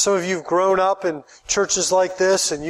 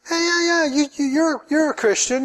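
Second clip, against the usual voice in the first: sly devil; 8 semitones higher than usual. Pretend. Devil flattering the listener.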